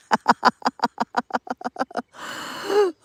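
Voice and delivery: long staccato cackling laugh; long real laugh. Real, not acted. Amused by the chat's reply, long real laugh. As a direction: laugh helplessly in a string of bursts.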